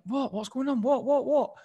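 high, baffled repeats; puzzled, not getting it; mock confusion when nobody can hear him; confused. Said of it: "I'm like".

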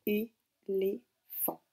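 "Éléphant" très lent, trois syllabes isolées; répéter "Éléphant" en pièces, é... lé... phant; découpé en syllabes. Shown as a demonstration. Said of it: "Donc on écoute bien". («Éléphant» répété très lentement, une syllabe à la fois)

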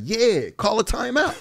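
high, laughing mimic of the teammate; mock cocky voice. Imitating the veteran telling him to call timeout. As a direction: play the smug veteran, half laughing. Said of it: "He's like".

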